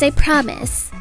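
ordinary delivery, nothing marked

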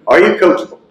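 ordinary talk, nothing marked

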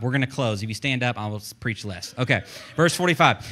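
usual speaking voice

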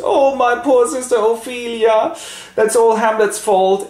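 high, loud mock lament; hammed-up grief turning to blame; wailing Laertes. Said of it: "And Laertes goes".

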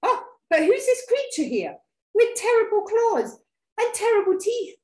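high, loud storybook fright; alarmed mouse. Acted. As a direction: gasp out mounting mock horror. Mouse meets the monster.

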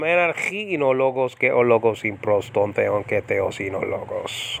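modern Greek with ke and o vowels; reading the Greek line plainly; modern Greek pronunciation. Shown as a demonstration. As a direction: ke theos in o logos, easy modern sounds. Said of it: "The ka is a ke nowadays".

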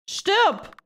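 very high acted yell; one sharp high-pitched shout; furious curse. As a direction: scream a curse after your captor. Captive curses departing captor.

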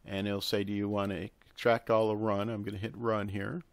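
usual speaking voice

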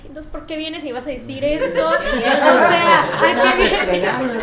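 loud, laughing indignant protest; mock inner argument. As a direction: argue back in exasperation, half laughing as it climbs. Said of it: "Yo ya estaba peleando en mi cabeza". (protesta indignada en broma, entre risas)

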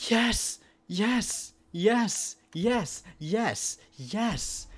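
repeated high cheers of joy; excited yelling. Real, not acted. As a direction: shout yes in triumph. Finds the key is useful.

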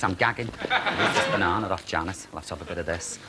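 normal talking voice

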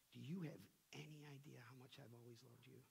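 soft, loving whisper of God; tender God. Acted. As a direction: speak it like a father forgiving his child. God speaking tenderly to sinner.

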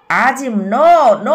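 drawn-out swooping cry of no. A narrator's reading. Azim cries out as Adil is shot, shouting. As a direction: stretch each no into a loud, rising wail. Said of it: "అని అరుస్తున్నాడు".

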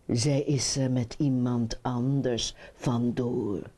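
put-on low-pitched old frog. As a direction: break the news in a low voice. Old frog breaks the bad news. (lage stem van de oude kikker)

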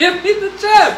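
loud, laughing demand. Real, not acted. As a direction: blurt it out through laughter.

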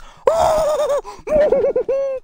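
long high-pitched shriek of joy; ecstatic scream. Real, not acted. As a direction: scream with delight, loud and very high. Huge pack pull, screams.

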